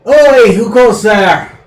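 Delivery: loud, slow gruff tavern-keeper call; rough barkeep challenge; barmaid voice. Acted. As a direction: hail the strangers like a burly barmaid. GM voices bartender greeting.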